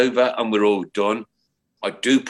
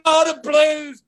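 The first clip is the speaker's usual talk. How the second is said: much higher, excited cry of a Blues fan; delighted fan shout; 5 dB louder, slower than usual. Retold or imitated. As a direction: imitate the biker cheering for his club, pitched way up. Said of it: "And he went".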